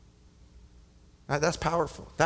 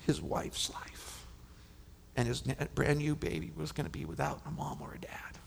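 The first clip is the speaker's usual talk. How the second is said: hushed whisper; 6 dB quieter than usual. Real, not acted. Pastor tells congregation of a father's ruined life, whispering. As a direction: whisper it, heavy with the weight of it. Grieving father's doom retold.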